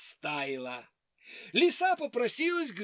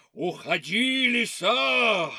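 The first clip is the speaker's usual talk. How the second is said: scary bear voice; 6 dB louder than usual. Acted. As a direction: roar it like a bear. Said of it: "закричал страшным голосом".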